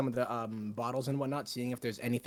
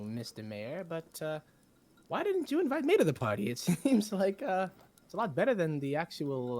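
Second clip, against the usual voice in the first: high cheerful bard voice; 6 semitones higher, 4 dB louder than usual. Acted. Bard character introduces himself.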